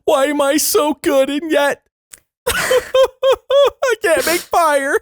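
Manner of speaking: high, slow hammed-up sob; mock crying brag. Podcaster imitating a crying contestant, high wailing sob. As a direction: wail it like a crybaby boasting. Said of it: "even when he's crying".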